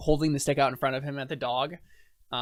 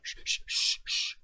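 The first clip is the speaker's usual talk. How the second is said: breathy shushing; steady quick shh sounds; shushing the dog. Acted. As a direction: hush a barking dog. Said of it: "to the dog".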